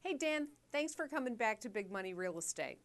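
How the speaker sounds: normal talking voice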